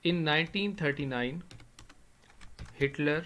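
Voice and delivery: very slow, each word spaced; writing the sentence on the board; slow dictation. Shown as a demonstration. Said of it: "ایک sentence لکھتا ہوں".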